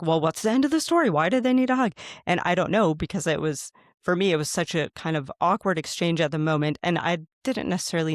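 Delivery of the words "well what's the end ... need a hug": higher-pitched, eager audience questions; voicing other people's questions in a raised pitch; imitated curious questioners. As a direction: ask as the curious crowd always does, pitched up.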